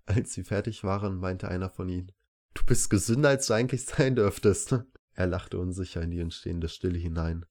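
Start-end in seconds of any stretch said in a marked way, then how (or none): 2.5-4.8 higher, louder quoted paramedic; paramedic's verdict. A narrator's reading. Paramedic to the examined patient, quoted verdict. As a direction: lift it up and louder for the medic. Paramedic's remark voiced in story.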